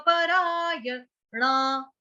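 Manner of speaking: परायणा slowly with ण as in बाण, tongue curled; retroflex na. Shown as a demonstration. Teacher showing ण as in बाण. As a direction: stretch परायणा, curl the ण. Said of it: "अण बाण वाला".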